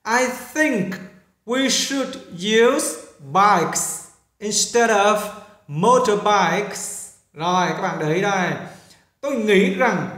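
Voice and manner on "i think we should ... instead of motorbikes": slow model reading. Shown as a demonstration. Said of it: "teacher models English slowly".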